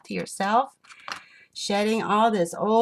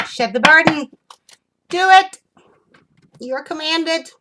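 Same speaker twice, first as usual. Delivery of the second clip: high, loud commanding shout; forceful command; 9 semitones higher, 10 dB louder than usual. Real, not acted. Reader orders viewer to shed the burden, shouted command. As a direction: belt out the order, pitched way up.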